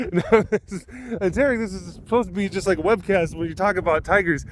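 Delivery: usual speaking voice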